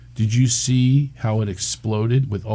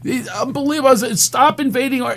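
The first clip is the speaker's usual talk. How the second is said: indignant; 11 semitones higher than usual. Retold or imitated. Retelling heated argument.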